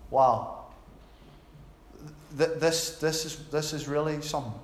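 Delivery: low, awestruck murmur; softly stunned, halting; hushed awe. Acted. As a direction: let the view quietly sink in. Acts out awe at Grand Canyon.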